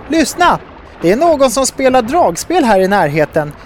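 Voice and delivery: high, urgent call to listen. A narrator's reading. Sol alerting crew to music nearby, calling out. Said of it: "ropade Sol".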